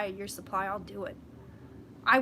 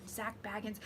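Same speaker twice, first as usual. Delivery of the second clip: whisper; 12 dB quieter than usual. Real, not acted. Whispering a ghost host's name.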